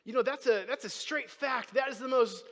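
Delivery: high, loud, forceful testimony; emphatic preaching. Real, not acted. Preacher sharing his truest truth with the congregation, emphatic preaching. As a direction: proclaim it as absolute truth.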